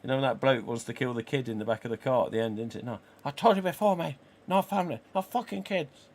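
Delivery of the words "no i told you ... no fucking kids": a hammed-up Cuban gangster accent; Scarface gangster accent. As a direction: snarl it like the Scarface gangster refusing. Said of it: "that sort of attitude and bloody accent and voice".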